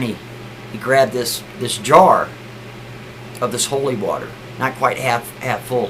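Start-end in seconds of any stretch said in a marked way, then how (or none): none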